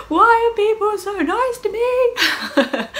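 very high, loud, slow wail broken by laughter; mock tearful wail. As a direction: replay your own happy sobbing, half laughing. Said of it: "I was like".

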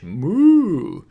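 loud, high, drawn-out moo; cow's moo. Shown as a demonstration. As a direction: imitate a cow at full voice. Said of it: "what cows say in English".

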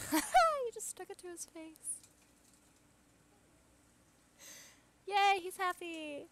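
high, loud, slow gushing with a laugh; high laughing delight; delighted cooing. Real, not acted. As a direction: cheer for the little guy. Cooing at game character.

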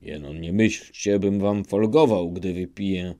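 usual speaking voice

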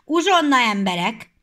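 loud, high call to break; shouted. Acted. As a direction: call the workers to snack. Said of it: "elkiáltotta magát".

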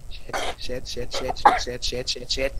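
soft, low repeated curses; quiet string of curses mid-game; low muttered panic swearing. Real, not acted. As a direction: swear under your breath over and over. Repeated swearing during tense play.

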